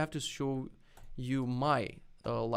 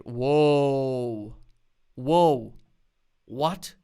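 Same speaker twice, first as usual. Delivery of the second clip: loud, drawn-out awe; real, loud amazement; amazed; 10 dB louder, much slower than usual. Real, not acted. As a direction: let out a big astonished whoa. Amazed by a shader demo.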